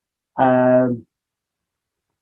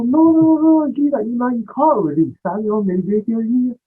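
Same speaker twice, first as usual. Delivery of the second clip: much higher, a little louder, hammed-up no no no; mock-frantic protest; 12 semitones higher, 3 dB louder than usual. Teasing his friend over a terrible track, high mock panic. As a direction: squeal it out in playful alarm. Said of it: "I go".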